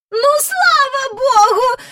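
very high, slow, loud relieved lady; relieved exclamation. A narrator's reading. Miss Lark relieved her dog is back, high sigh. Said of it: "вигукнула міс Жайворон і голосно зітхнула".

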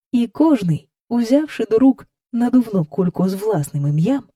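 ordinary delivery, nothing marked

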